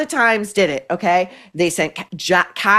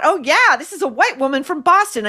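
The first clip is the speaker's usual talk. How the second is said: higher-pitched voice of people catching on; mock dawning recognition, pitched up; mock sudden realization; 7 semitones higher than usual. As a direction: imitate a crowd finally figuring it out.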